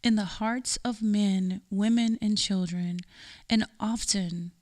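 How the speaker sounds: ordinary talk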